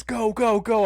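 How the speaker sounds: high urgent retold command to drive; urgent go go go from the girl. Fleeing in car, urgent.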